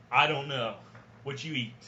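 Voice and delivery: ordinary delivery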